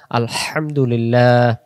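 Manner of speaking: slow "আলহামদুলিল্লাহ" with hissing mid-throat h; throaty long-letter ha. Shown as a demonstration. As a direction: let the h of "আলহামদুলিল্লাহ" rush from the throat. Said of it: "লম্বা হা".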